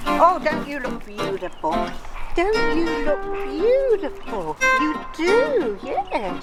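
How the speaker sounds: high, drawn-out doting praise; pet-directed cooing. Real, not acted. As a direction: coo adoringly, as if to a beloved dog.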